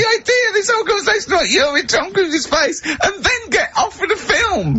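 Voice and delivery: laughing helplessly. Real, not acted. Host cracks up mid-sentence.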